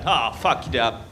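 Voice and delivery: ordinary talk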